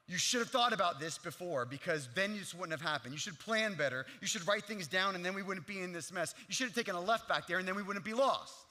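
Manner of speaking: loud, lecturing should-have-done scolding; confrontational. Retold or imitated. Husband lecturing his wife, loud and judgmental. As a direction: nag like a know-it-all husband. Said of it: "In this confrontational kind of way".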